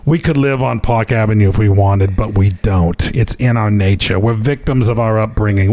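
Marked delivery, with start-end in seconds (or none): none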